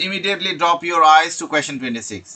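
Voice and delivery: slow, deliberate reading of a study tip; slow slide reading. Acted. Teacher reads the tip to IELTS students, slowly. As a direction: read it out word by word like a slide.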